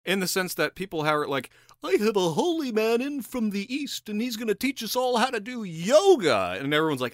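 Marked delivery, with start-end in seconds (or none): 1.8-6.6 high-pitched, gushing aristocrat parody; mock rich socialite. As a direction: brag in a silly high society voice. Imitating aristocrats showing off a guru.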